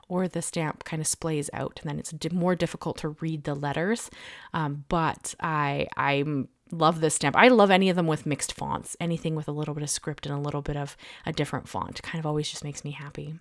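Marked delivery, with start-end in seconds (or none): none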